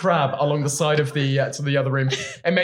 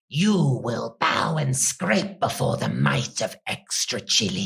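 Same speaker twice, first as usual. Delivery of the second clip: goblin voice; 3 semitones lower, slower than usual. Acted. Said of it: "The goblin says".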